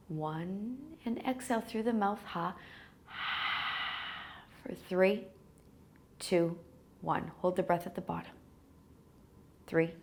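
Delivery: soft, spacious breath-counting voice with long pauses; guided meditation. Real, not acted. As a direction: lull them gently toward sleep, leaving space to breathe. Leads breath count and body scan.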